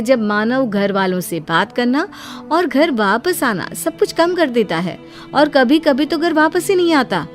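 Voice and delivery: ordinary delivery, nothing marked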